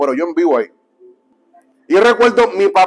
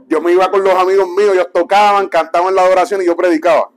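ordinary talk, nothing marked

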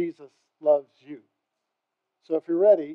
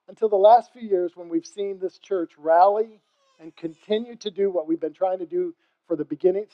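ordinary talk

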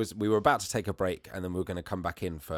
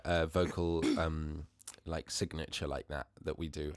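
usual speaking voice

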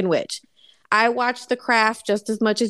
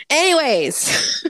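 long, higher-pitched transition word; drawn-out segue; 5 semitones higher, much slower than usual. Real, not acted. Host wrapping up after a rant, drawn-out segue. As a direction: stretch it out to shake off the rant.

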